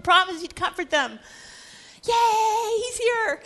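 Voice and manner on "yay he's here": crowd cheer. Acted. Crowd cheering Jesus' arrival.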